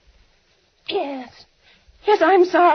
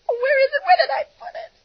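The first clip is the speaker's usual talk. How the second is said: much higher, crying voice; frantic panic; 9 semitones higher than usual. Acted. Panicked woman crying out searching for her clock. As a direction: search wildly, voice breaking.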